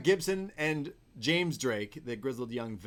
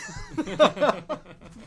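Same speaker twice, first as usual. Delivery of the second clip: burst of laughter; 9 dB louder than usual. Real, not acted. Cracking up at the jeans.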